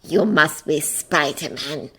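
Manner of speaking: low, slow cold villain voice; quiet grating menace. A narrator's reading. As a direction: say it coldly, low and unhurried. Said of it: "cold and grating".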